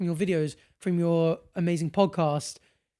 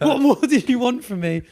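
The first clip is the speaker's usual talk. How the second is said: exasperated God; 6 semitones higher, 6 dB louder than usual. Retold or imitated. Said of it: "And he was like".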